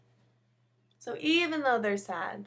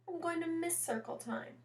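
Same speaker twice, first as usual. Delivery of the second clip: a light, higher wistful teacher voice; 8 semitones higher, 4 dB quieter than usual. A narrator's reading. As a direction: say it fondly, missing it already.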